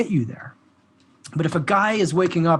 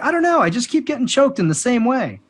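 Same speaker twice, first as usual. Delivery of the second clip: acted clueless plea, pitched higher; clueless student; 7 semitones higher than usual. Student telling training partners about getting choked, acted clueless. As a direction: play a puzzled student, voice lifted.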